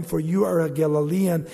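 ordinary delivery

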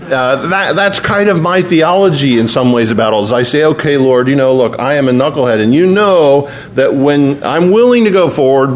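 ordinary talk, nothing marked

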